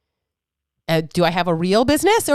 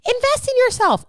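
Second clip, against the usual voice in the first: high-pitched mock preachy holler; over-the-top sing-out preaching. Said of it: "I can't sit here and preach".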